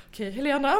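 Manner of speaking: high, slow mock-calming voice; fragile self-soothing. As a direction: coax yourself to breathe, barely coping. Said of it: "skör och bara". (skört, långsamt lugnande av sig själv)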